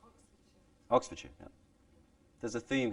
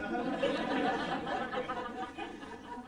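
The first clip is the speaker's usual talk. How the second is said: laugh; 7 dB quieter than usual. Real, not acted.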